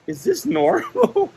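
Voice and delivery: very high, slow query; incredulous question. Retold or imitated. Friend asking staff about his taco, incredulous high question. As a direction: stretch it out high in mock disbelief.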